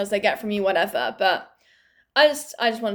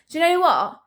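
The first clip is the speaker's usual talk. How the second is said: higher and louder, fed up; exasperated; 6 semitones higher, 5 dB louder than usual. Retold or imitated. Answering the scam caller, higher, louder and exasperated. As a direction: snap it out, done with it. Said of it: "And I'm like".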